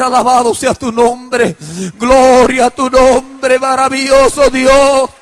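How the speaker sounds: slow, loud, drawn-out exultation; imitated fervent praise. Wife glorifies God on getting results, exultant. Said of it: "mi esposa empieza a alabar a Dios".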